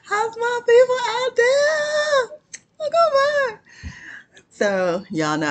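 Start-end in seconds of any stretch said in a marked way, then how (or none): 0.0-3.6 very high, slow squeaky greeting. Pretend. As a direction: stretch it out in a tiny high voice. Sing-song channel greeting.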